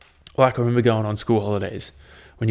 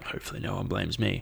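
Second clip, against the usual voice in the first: much softer, a hushed inner voice; hushed mock thought; 2 semitones lower, 11 dB quieter than usual. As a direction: mutter it guiltily to yourself. Thought of silent fart sitters.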